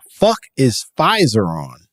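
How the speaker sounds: ordinary delivery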